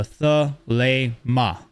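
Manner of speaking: thelema drawn out slowly as thuh, LAY, mah; syllable-by-syllable pronunciation. Shown as a demonstration. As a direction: say thelema slowly, one syllable at a time, long AY in the middle. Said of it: "That's how you pronounce it".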